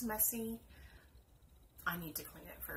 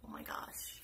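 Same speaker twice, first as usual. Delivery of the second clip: whispered oh my gosh; 6 dB quieter than usual. Real, not acted. Overwhelmed aside.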